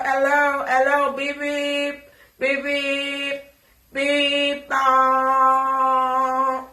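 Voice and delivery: loud teasing chant with beep noises; loud mocking sing-song. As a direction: sing the beeps out like a hyped taunt.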